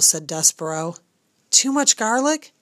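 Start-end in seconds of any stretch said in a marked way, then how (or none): 1.5-2.5 worried. A narrator's reading. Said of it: "wringing her fat hands".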